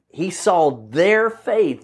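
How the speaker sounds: THEIR stretched and lifted, very slow delivery; slow, emphatic point landing on "their"; stress on THEIR. Shown as a demonstration. Preacher drives home whose faith it was.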